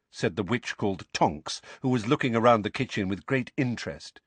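ordinary delivery

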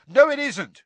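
loud, high angry shout. A narrator's reading. Ron contradicting Hermione in a row, shouting. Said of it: "shouted Ron".

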